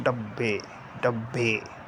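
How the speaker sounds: डब्बे low and soft, sinking at the end; falling tone on डब्बे. Shown as a demonstration. Teacher showing how the pitch falls, low falling डब्बे. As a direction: let डब्बे drop downwards, softly. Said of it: "बिल्कुल एक गिर रहा है ना".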